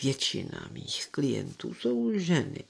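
low, soft, slow confiding voice; confidential. A narrator's reading. Poirot confiding in the doctor, hushed and confidential. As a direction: confide it quietly, as if leaning in. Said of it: "Poirot se důvěrně předklonil".

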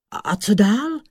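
high-pitched eager question. A narrator's reading. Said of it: "ptal se vodník Zelinka dychtivě".

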